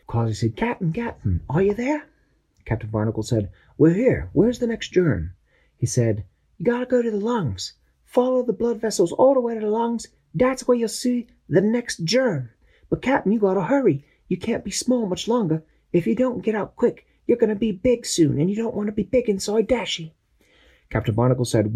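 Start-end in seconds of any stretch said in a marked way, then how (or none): 0.6-2.1 high voice calling over radio; Quasi's voice. Acted. 3.8-5.3 higher, lively captain giving orders; captain briefing his crew, voice pitched up; Captain Barnacle voice. Acted. Captain answers. 6.6-20.1 high voice calling over radio; Quasi's voice. Acted. Quasi gives directions.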